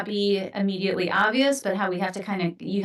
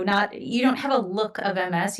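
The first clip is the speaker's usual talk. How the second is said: normal talking voice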